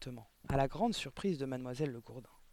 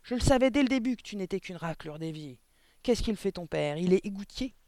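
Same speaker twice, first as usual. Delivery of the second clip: loud, higher-pitched contemptuous tirade; angry scolding; 5 semitones higher, 7 dB louder than usual. A narrator's reading. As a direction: berate the boy loudly. Directrice en colère insulte l'enfant. (colère méprisante, voix haute et forte)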